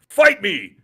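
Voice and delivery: short, loud, high challenge; mimicking a game boss's battle cry; imitated yell. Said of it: "and then he yells".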